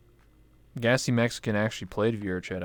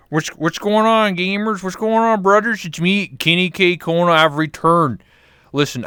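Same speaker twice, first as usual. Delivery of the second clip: loud hillbilly brother voice, pitched up; Kenny K Kona; 6 dB louder than usual. Acted. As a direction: play the rowdy hillbilly streamer greeting gamers. Character Kenny K Kona held.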